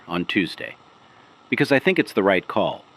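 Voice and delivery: ordinary talk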